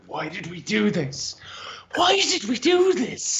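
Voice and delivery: high, slow wailing Irish lilt; mock Irish lament. Irish explorer freezing in the Arctic, wailing high and slow. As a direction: moan the question like a doomed Irish sailor.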